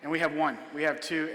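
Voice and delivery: ordinary delivery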